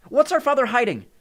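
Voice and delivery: high, loud demand for answers; demanding shout. A narrator's reading. Nico presses his sister's ghost, shouting his demand. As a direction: cry out, insisting on the truth. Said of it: "he demanded".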